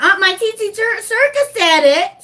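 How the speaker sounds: high-pitched mock tattletale; imitating tattling child. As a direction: mimic a little one telling on auntie. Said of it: "cannot have my baby in here talking about".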